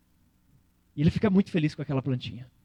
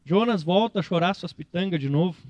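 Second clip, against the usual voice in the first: ordinary talk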